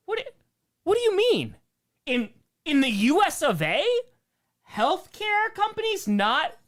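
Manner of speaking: high, loud, incredulous what do you mean; mock disbelief. Streamer mock-shocked at a chat comment about healthcare companies. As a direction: feign shock at the question.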